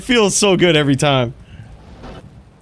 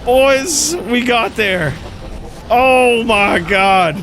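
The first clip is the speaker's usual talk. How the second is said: giddy relief; 8 semitones higher, much slower than usual. Real, not acted. Celebrating the rare drop.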